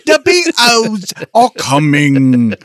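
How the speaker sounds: slow, high hammy British voice; pompous sputtering colonel; bad British accent. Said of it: "does the worst British accent on purpose".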